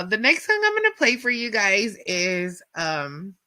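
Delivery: usual speaking voice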